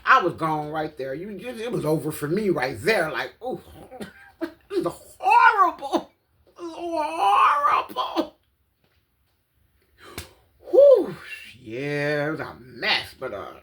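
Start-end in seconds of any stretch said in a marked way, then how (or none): 3.4-8.4 high, slow whimpering cry; horrified whimper. Retold or imitated. Watching the needle scene, whimpering in horror. Quoting her own horror. 10.7-11.2 high loud relieved whew. Real, not acted. Finished recalling the video, a loud relieved whew.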